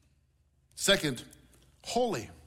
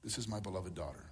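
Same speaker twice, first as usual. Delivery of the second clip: much softer and lower; tender whisper-soft blessing; 9 semitones lower, 13 dB quieter than usual. Pretend. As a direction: hush it like a loving father. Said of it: "that same voice of the Father speaks over your life".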